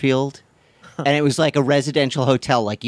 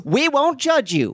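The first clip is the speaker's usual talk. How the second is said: put-on mid-Atlantic accent; 13 semitones higher, slower than usual. Old-timey ad bit.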